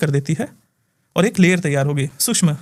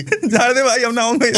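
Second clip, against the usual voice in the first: higher, laughing imitation of a pleading ghost; mock begging spirit; 6 semitones higher than usual. Healer re-acts the spirit's plea for his host, higher and amused. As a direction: ham up the spirit begging for mercy. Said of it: "विचित्र किस्म की ना बातें करने लगा".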